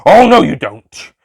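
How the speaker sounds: loud, high, slow shout of protest. A narrator's reading. Doctor shouting to stop the Master, loud. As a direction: yell it as you rush forward. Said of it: "the Doctor shouted".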